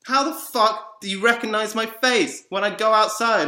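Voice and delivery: loud, high, outraged demand; angry shout. Real, not acted. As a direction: yell it as an accusation. Shouts at people who judge him.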